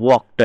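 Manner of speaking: "వాక్డ్" said as wokt; t ending shown. Shown as a demonstration. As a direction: finish "వాక్డ్" on a t sound, not d. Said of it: "టా వచ్చింది".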